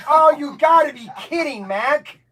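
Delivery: loud, high disbelieving yell at a friend; loud and incredulous; exasperated shout. Real, not acted. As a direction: shout in mock outrage at Mac. Exasperated at coworker Mac's injury.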